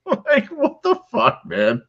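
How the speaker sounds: very high laughing squeak; laughing disbelief. Real, not acted. Host laughs in disbelief at Leon's stunt.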